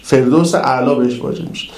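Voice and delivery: usual speaking voice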